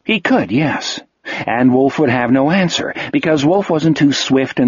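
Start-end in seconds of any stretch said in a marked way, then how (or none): none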